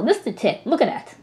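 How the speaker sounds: ordinary talk, nothing marked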